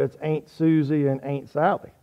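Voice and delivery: South Georgia "Aunt" with an ain sound; South Georgia accent. Shown as a demonstration. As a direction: say "Aunt" like the grandparents. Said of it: "my grandparents' hometown in South Georgia".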